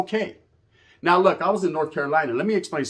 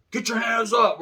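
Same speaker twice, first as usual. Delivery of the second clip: high barked police command; cop's order; 9 semitones higher than usual. Retold or imitated. Police pull over the car, barking an order. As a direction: imitate a cop shouting at them.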